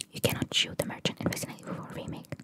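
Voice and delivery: usual speaking voice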